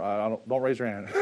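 ordinary delivery

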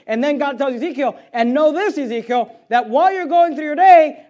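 very loud, high-pitched sermon; forceful preaching. Acted. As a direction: drive the message home to the congregation.

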